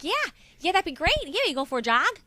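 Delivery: high, loud Cincinnati reply; answering mother-in-law in broad Cincinnati accent. Retold or imitated. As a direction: mirror the Cincinnati twang back. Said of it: "talking back to my mother-in-law".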